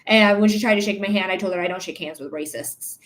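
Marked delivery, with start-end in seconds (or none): 1.6-3.0 much softer, lower, cold retort; cold quiet refusal. Retold or imitated. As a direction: say it low and cold, shutting her down. Retold refusing racist's handshake.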